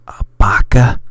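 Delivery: very loud voiceless burst; mocking insult. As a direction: blast it out with no tone in the voice. Insult acted with harsh burst.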